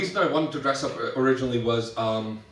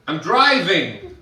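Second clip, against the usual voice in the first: loud, slow, higher reply; explaining over music; 4 semitones higher, 6 dB louder, slower than usual. Retold or imitated. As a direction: stretch it out over the bar noise. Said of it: "is always way too loud".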